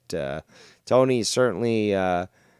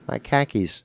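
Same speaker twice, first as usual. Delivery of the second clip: "khakis" said like "car keys" in Boston speech; mock Boston accent. Joking translation for Bostonians, mock Boston accent. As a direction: imitate a Bostonian saying "khakis". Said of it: "translation for Bostonians".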